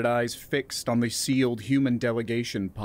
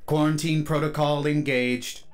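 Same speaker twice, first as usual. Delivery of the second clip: higher, raised machine announcement; booming AI announcement; 4 semitones higher than usual. Acted. Chamber AI declares quarantine to everyone, booming and emotionless. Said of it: "boomed the A I's emotionless voice".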